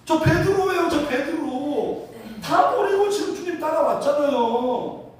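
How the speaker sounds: acted-out indignant protest, high-pitched; wounded pride. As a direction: insist on your worth, offended. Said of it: "너무 서운한 거야". (자존심 상해 서운하게 항의하듯)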